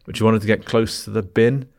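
ordinary talk

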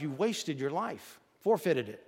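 usual speaking voice

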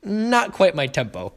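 deliberate, stretched-out disapproval; controlled correction. Retold or imitated. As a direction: imitate a conductor drawing out a cool correction. Bandleader stops band, stares, corrects.

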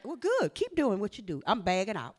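usual speaking voice

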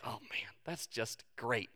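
very soft, low and slow; mock mild approval. Churchgoer hearing a familiar verse, mock mild approval. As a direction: murmur it as an underwhelmed reaction. Acted bland churchgoer reaction to scripture.

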